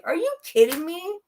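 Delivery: fed-up daughter, mock incredulous; exasperated disbelief. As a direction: throw up your hands in disbelief. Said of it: "and I'm like".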